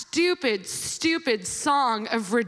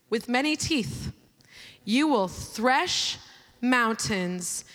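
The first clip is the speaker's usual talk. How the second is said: slow, low, weighty reading of God's words; solemn scripture reading; 6 semitones lower, slower than usual. Acted. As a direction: read each word slowly like a proclamation. Reads scripture aloud.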